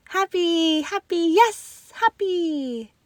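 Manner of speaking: put-on high, happy child voice, drawn out; a small boy bursting with joy; excited child. A narrator's reading. Lucas answers excitedly.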